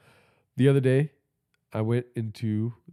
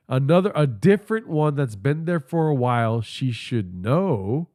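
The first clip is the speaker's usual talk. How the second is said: slow sarcastic repeat; 3 dB louder than usual. Acted. Repeats with slow drawn end.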